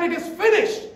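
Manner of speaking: high, loud cry of victory; triumphant shout. Retold or imitated. Christ on the cross shouting his victory to all. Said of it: "shouted with a loud cry".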